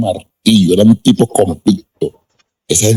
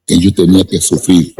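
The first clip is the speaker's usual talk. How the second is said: usual speaking voice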